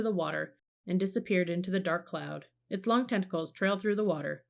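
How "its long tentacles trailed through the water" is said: ordinary delivery, nothing marked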